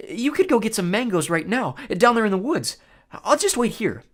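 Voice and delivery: high, loud nervous babble; stammering frightened satyr. Acted. Grover bargaining with the Cyclops, stammering high voice. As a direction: talk fast to save your skin.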